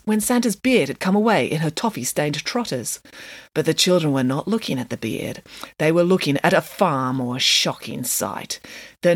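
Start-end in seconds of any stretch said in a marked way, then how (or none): none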